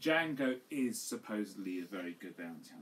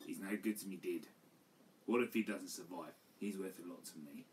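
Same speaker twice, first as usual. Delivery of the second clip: quoted line in a softer everyday voice; just read the line out flat before the parody; simple low-key quote of the movie line; plain quote of the line; 5 semitones lower, 5 dB quieter than usual. Retold or imitated. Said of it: "doesn't even say the lines properly".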